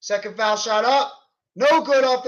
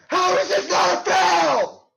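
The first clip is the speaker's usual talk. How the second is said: high, loud disbelieving yell; how is that not a foul; outraged at the refs; 9 semitones higher, 3 dB louder, slower than usual. Real, not acted. Fan yelling at the refs over a non-call, furious disbelief.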